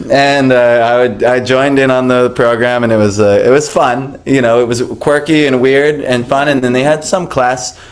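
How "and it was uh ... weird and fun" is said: ordinary talk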